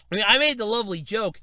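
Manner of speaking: usual speaking voice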